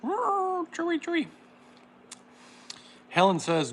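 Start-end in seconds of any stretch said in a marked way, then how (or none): 0.0-1.3 high Wookiee-like howl; Chewbacca. Pretend. Host mimicking Chewbacca for viewers, high howl. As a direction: yowl it like Chewbacca. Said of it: "I sound more like Chewbacca".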